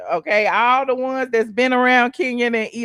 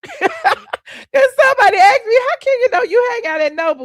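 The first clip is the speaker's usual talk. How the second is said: high, loud laughing disbelief; 12 semitones higher, 7 dB louder than usual. Real, not acted. Laughing retelling of a question.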